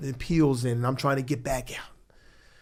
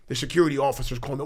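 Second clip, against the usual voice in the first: usual speaking voice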